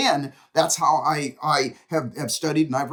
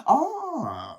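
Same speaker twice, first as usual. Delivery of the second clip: long high "ahh" falling at the end; drawn-out "ah" of realization; 9 semitones higher than usual. Acted. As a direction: let out a big aha of dawning understanding. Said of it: "get them to go like, ... like that".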